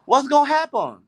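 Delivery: high-pitched imitation of Indonesian coworker; high, insistent coworker voice. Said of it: "this Indonesian lady I work with".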